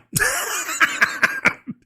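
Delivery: high, soft cackle; real high-pitched chuckling burst; laughing. Real, not acted. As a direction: laugh it off gleefully. Laughs after saying he doesn't care.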